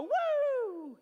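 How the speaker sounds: very high, loud cheering woo; excited woo. Shown as a demonstration. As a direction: whoop like a celebrating athlete. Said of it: "Or you could just go".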